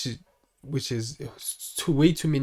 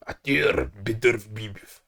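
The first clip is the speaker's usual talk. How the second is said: "Artur Beterbiev" in a slow, lower, mock Russian accent; 5 semitones lower, slower than usual. Shown as a demonstration. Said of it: "my failed Russian accent".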